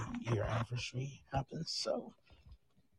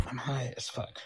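whispering. Real, not acted. Whispers while streaming at night.

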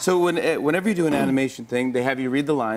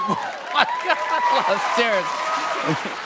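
tumbling down stairs; 13 semitones higher, much slower than usual. Pretend. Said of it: "You are falling down two flights of stairs".

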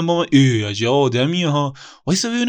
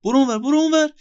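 shooing away; 9 semitones higher, faster than usual. Acted. Shoo away the other voice.

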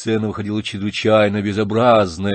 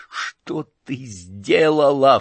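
anguished shout; 4 semitones higher, much slower than usual. Acted. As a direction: cry out in despair. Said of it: "вскричал он".

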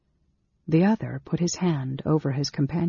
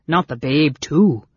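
understated astonishment, punched a little louder; astonished; 5 dB louder than usual. A narrator's reading. Innkeeper fearing for the baby, mildly astonished. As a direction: ask in mild dismayed disbelief.

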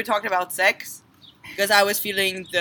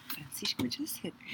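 whispering; 15 dB quieter than usual. Real, not acted. Whispered complaint.

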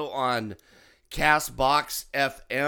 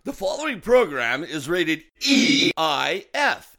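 announcer voice; 3 semitones higher, 6 dB louder than usual. Shown as a demonstration. Demonstrates the announcer intro.

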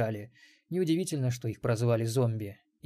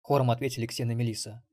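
ordinary delivery, nothing marked

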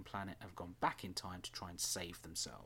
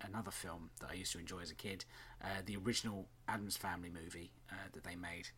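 usual speaking voice

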